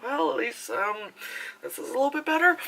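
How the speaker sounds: high, soft, half-hearted reassurance; small high voice, looking on the bright side; sheepish consolation. Retold or imitated. Joking about who the film's villains are, mock sheepish.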